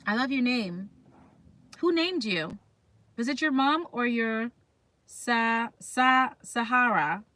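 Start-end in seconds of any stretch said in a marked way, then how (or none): none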